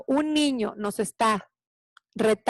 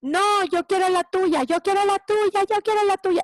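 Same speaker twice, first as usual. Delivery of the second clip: put-on much higher, insistent kid's clamour; acted tantrum, pitched way up; child's yelling tantrum; 8 semitones higher, 3 dB louder than usual. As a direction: imitate a child yelling the same demand repeatedly. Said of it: "gritoneando que él quiere la mía".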